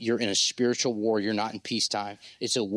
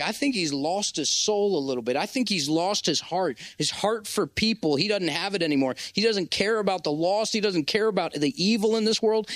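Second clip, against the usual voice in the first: raised, weary lament of a man who's lost heart; despairing inner voice; 8 semitones higher than usual. Retold or imitated. Preacher voicing his own past despair, raised and lamenting. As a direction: voice the doubting self, pitched up. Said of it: "I'm like".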